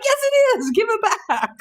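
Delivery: joking tug-of-war over a wad of cash; playful insisting. Retold or imitated. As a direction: demand it back in mock outrage. Playful quarrel over found money.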